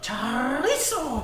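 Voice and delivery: high, very slow, drawn-out "Chorizo"; overacted anime dub. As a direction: stretch "Chorizo" like a dramatic anime hero. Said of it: "You sound like an anime character".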